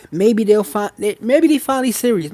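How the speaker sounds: high, eager voice of a hopeful partner; hopeful inner voice. Retold or imitated. Coach voicing a partner who hopes he changed, high and eager. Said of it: "You hear that hope?".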